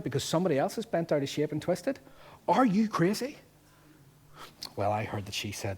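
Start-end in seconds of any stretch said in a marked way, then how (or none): 2.5-3.4 incredulous. Real, not acted. Preacher challenging congregation.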